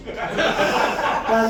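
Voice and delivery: ordinary delivery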